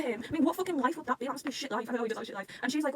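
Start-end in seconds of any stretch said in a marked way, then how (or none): none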